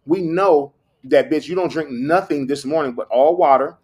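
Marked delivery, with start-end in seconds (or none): none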